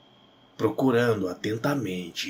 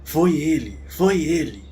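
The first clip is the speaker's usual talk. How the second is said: higher, louder urgent repetition; alarmed insistence; 7 semitones higher, 5 dB louder than usual. A narrator's reading. Wife points out her attacker to husband, alarmed. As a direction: cry it out twice, pitch raised.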